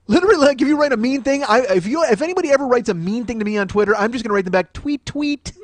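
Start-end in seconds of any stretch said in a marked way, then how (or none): 4.7-5.5 high, chirpy little tweet; mock bird tweet. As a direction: tweet it back mockingly in a raised pitch. Mock reply to mean tweets.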